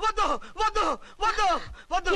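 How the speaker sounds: panicked protest. Acted. Patient stopping a woman changing before him, panicked. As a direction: shout it in alarm, in quick bursts.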